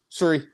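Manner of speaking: SURREY with a rounded first vowel, falling; imitated Canadian sorry. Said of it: "you always say".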